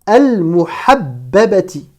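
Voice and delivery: slow, isolated word; careful Arabic reading model; detached word-by-word. Shown as a demonstration. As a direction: read it out alone, syllable by syllable. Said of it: "chaque mot de manière détachée".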